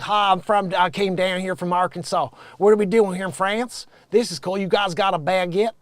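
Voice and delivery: hammed-up American Southern twang; Southern accent. American mom asking about baguettes in France, mock Southern accent. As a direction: lay on a country Southern accent. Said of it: "She's got the stupid accent".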